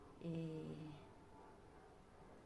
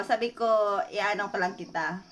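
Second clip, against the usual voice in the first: normal talking voice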